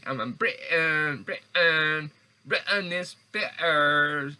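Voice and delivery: slow, hammed-up British with the T in Britain swallowed; mock British accent. Shown as a demonstration. Mocking how British people drop the T, slow mock British accent. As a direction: drag out Britain as Bri'ain like a bad British impression. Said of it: "how you say all this here stuff without the T?".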